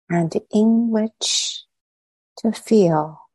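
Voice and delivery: unhurried meditation guidance with long pauses between phrases; measured phrases set apart by spacious silences; guided meditation. Real, not acted. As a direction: lead them inward, leaving silence after each short phrase.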